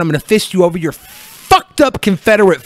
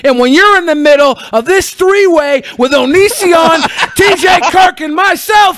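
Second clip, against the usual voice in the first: higher, louder, building trash-talk roar, slowing on the last line; wrestling promo shout; 7 semitones higher, 4 dB louder than usual. Acted. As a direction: scream the promo into the camera. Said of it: "you just cut a promo".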